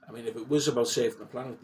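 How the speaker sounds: ordinary talk, nothing marked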